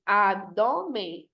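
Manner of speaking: "Abdômen" very slowly, broken into syllables. Shown as a demonstration. Teacher counting three syllables, Abdômen slowly split up. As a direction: split Abdômen into drawn-out beats. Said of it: "Trissílaba".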